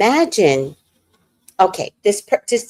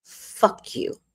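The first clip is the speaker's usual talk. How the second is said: breathy retold curse; 9 dB quieter than usual. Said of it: "and she goes".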